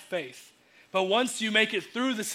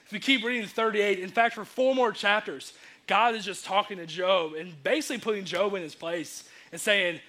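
normal talking voice

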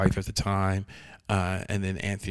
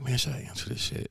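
much softer, voiceless aside to himself; muttered inner thought; 3 semitones higher, 10 dB quieter than usual. Retold or imitated. Voicing his own reluctant thought.

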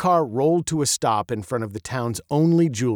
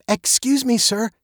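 put-on polite, higher girl's voice; 10 semitones higher than usual. A narrator's reading. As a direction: politely get a stranger's attention. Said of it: "she asked politely".

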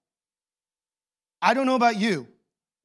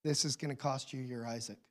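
hushed, tempting murmur, softer; sly quiet voice of sin; 6 semitones lower, 11 dB quieter, faster than usual. Acted. Sin knocking at the door, a hushed temptation. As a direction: lean in and tempt him quietly. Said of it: "sin comes knocking and says".

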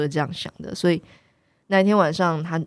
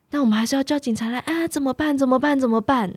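higher, fretful "what do we do"; mock-panicked repeating, pitched up; anxious fretting; 7 semitones higher than usual. The archer's wife frets to the narrator about calling police, anxious and high.

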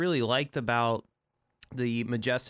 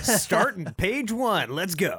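hyped announcer; 8 semitones higher, 5 dB louder than usual. Acted. Hyped kickoff of reading.

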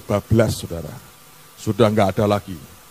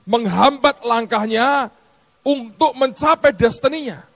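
impassioned sermon voice, raised high; fiery preaching; 11 semitones higher than usual. Acted. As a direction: preach it hard to the congregation.

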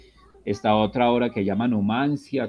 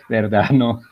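laughing agreement; 8 dB louder, slower than usual. Real, not acted.

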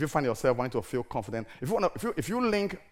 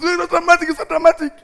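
high, loud teasing singsong; mock the family's complaint, pitched up; 14 dB louder, faster than usual. Family at home teasing the preacher, high and loud mockery. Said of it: "every little night they say".

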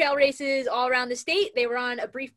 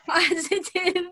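laughing through the word, higher; 7 semitones higher, 6 dB louder, slower than usual. Real, not acted. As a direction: giggle out "positive". Host laughs on repeated word.

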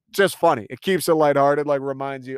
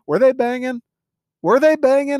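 much higher, sly insinuating question; teasing gossip; 10 semitones higher, 4 dB louder than usual. Real, not acted. Viewer asks the audience about Harlan and Marta, teasing. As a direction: stir up gossip with a grin.

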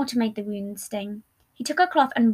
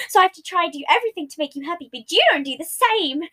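louder, higher indignant complaint; rising volume; 6 semitones higher, 4 dB louder than usual. A narrator's reading. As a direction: raise your voice in a hurt protest. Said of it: "his volume rose".

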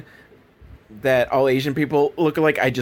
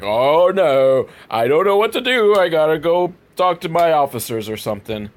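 hammed-up doofus, pitch swooping up; 3 semitones higher than usual. Retelling the film's officer dithering, hammed-up doofus voice. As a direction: play a hapless doofus in mock dismay.

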